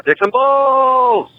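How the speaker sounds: very high, slow squealed sign-off; borrowed sign-off. Retold or imitated. Caller copying a co-host's sign-off, squeaky and drawn out. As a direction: stretch it into a squeaky catchphrase. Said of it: "Wind Tunnel and Fred French has got one".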